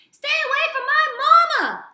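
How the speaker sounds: high, piercing shriek of a protective gosling; fierce shout. A narrator's reading. Gosling swooping between bears and his mother, screaming. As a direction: scream it to shield your mother.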